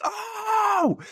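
sudden very high delighted yelp; excited squeal. Retold or imitated. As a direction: squeal like a thrilled little kid. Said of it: "I was like".

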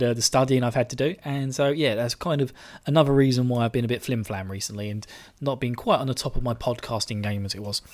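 ordinary talk, nothing marked

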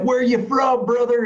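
mock American fan cheering Fuck yeah; cheering American dudes. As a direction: whoop it up like stoked patriotic guys in Vegas. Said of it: "And they're like".

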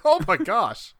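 hammed-up horrified reaction; mock horror. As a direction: exclaim like an appalled, naive kid. Retold horrified childhood reaction to film.

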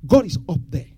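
usual speaking voice

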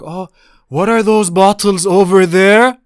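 high, loud, slow mocking whine of the nosy uncle. Mocking his nosy uncle's question, high loud whine. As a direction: stretch it out in a shrill put-on uncle voice.

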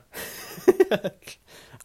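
loud, high cackling laugh; real laugh. Real, not acted. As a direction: burst out laughing. Laughs at own story.

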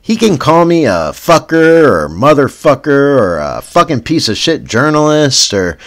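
normal talking voice

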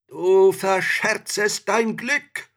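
slow, high, loud warning; urging spirit. A narrator's reading. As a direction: implore and warn from inside the bottle. Trapped spirit urges the pupil.

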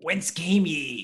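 slow raspy duck voice; lightly voiced gruff duck; Bronx duck voice. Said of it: "He's a real raspy".

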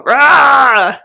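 loud hammed-up ape roar; mock monkey roar. Acting out King Kong.

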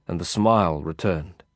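ordinary delivery

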